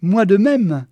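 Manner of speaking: high, loud and effusive; gushing greeting. Acted. Geneviève answers Walter's introduction, gushing. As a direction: return the greeting with gusto.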